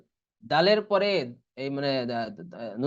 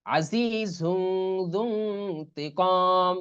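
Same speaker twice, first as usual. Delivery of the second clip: very slow, long-held recital; Quran recitation; much slower than usual. Shown as a demonstration. Teacher recites the example slowly for students. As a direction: stretch the nasal sound long. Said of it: "মিম নুন জের মিন".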